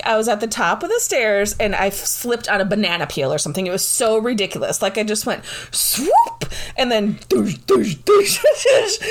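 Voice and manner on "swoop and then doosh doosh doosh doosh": mock falling sound effects. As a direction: act out each bump down the stairs with a laugh.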